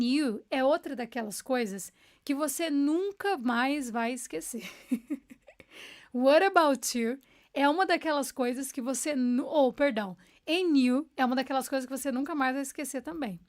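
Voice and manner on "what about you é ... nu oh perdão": usual speaking voice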